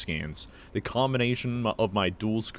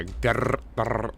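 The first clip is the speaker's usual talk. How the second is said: rolled, buzzing "grrr" of a scanning device; imitated scanner noise. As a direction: mimic a device crackling as it scans. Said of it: "sound effect with it".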